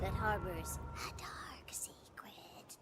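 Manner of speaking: slow hushed secret; spooky storyteller whisper; ominous whisper. Acted. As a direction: whisper it like a dark omen. Ominous aside in narration.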